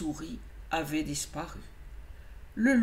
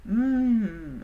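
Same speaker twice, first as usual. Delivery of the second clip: hmm gourmand; 3 semitones higher, 4 dB louder than usual. Acted. Pense au lièvre blanc, gourmand.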